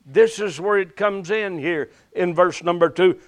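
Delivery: slow, rising preacher's cadence; preaching. Acted. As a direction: preach it with fervor. Preaching on Jehovah Shalom.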